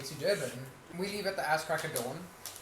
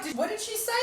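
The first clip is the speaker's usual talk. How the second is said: mock Ashley. Imitating Ashley in car.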